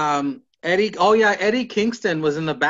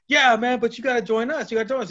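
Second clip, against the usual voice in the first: eager recruiting; 8 semitones higher, faster than usual. Retold or imitated. Retold eager recruiting pitch.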